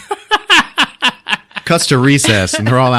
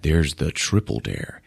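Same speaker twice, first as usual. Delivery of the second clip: ordinary talk, nothing marked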